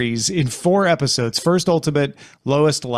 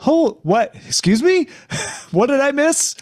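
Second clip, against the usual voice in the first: high-pitched mock confusion; acted bafflement; 10 semitones higher than usual. Viewer re-enacting confusion at an unexpected recap, high and baffled. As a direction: squeak out baffled questions, half laughing. Acts out his confused first reaction.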